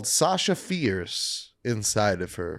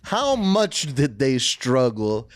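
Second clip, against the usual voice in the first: mocking; 5 semitones higher than usual. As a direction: drag it out like a snobby purist. Mocking punk authenticity gatekeepers.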